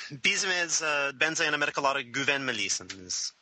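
greeting in fluent Turkish. Shown as a demonstration. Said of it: "say anything to our Turkish listeners".

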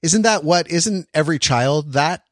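ordinary delivery

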